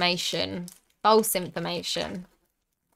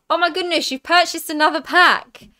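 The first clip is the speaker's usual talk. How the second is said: higher, louder exclamation; delighted surprise; 7 semitones higher, 5 dB louder than usual. Real, not acted. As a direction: react with real, excited surprise. Surprised by another pack purchase.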